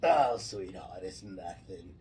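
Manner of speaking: low put-on Jersey smoker voice; New Jersey accent. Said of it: "nobody in New Jersey sounds like that".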